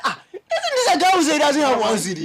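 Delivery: high, loud, amused mock outrage; acted indignant shout. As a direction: play the mate loudly exposing the passenger. Mate confronts passenger in retold story.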